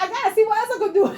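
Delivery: high, loud and fast through laughter; an overjoyed old lady laughing as she rushes to clean; laughing excitement. Retold or imitated. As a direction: gush it out, giggling with joy. Client overjoyed, kicks him out.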